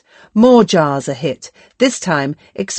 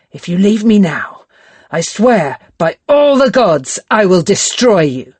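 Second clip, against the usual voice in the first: louder, menacing threat; shaking anger; 3 semitones higher, 4 dB louder than usual. Acted. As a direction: swear revenge through rage. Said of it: "hand shaking with anger".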